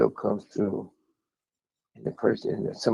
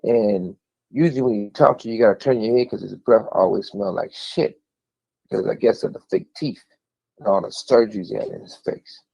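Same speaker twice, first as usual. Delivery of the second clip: ordinary talk, nothing marked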